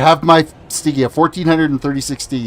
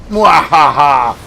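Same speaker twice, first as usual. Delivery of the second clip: mad scientist laugh; 5 dB louder, much slower than usual. Pretend. Gloating after a kill.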